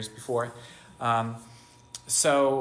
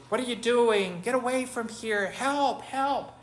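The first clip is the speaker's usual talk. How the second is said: high, loud panicked call for help, rising higher to the last help; a girl's desperate retold cry for help, much higher; 10 semitones higher, 4 dB louder than usual. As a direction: scream for help as if fighting someone off. Girl calling for help against attacker.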